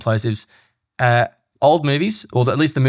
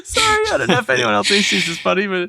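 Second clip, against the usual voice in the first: talking through laughter; giggling while speaking; laughing; 9 semitones higher than usual. Real, not acted. Laughing at friend's impression.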